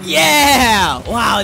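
high, loud triumphant whoop; excited yell. Real, not acted. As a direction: cheer the win at full voice. Nails a great run in game.

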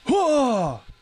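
loud high startled gasp; fake heart attack gasp. Real, not acted. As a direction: gasp in pure shock. Jump scare by old woman.

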